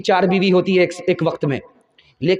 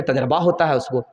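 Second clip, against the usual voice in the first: usual speaking voice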